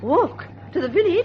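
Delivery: can't believe what she hears; aghast disbelief. Acted. Aunt aghast at the five-mile walk, incredulous.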